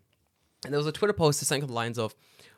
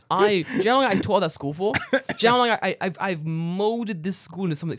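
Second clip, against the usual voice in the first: outrage; 5 semitones higher, 4 dB louder than usual. Acted. Outraged retort to teacher.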